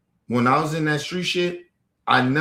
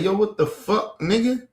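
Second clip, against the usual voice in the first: upset parents' outburst; 7 semitones higher than usual. Retold or imitated. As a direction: imitate upset parents catching their kid in street life. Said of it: "They was upset about that".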